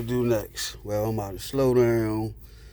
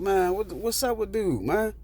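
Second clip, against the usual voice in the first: exasperated; 8 semitones higher than usual. Retold or imitated. Annoyed at man touching his machine.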